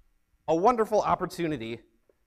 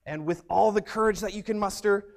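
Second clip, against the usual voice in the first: ordinary talk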